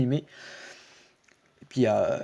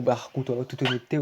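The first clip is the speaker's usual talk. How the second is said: normal talking voice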